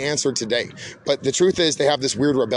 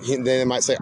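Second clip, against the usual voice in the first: ordinary delivery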